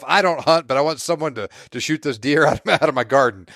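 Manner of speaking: lightly voiced fed-up neighbor. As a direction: lightly act out a neighbor griping to a hunter. Voicing deer-plagued island neighbors.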